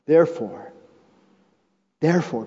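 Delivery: ordinary talk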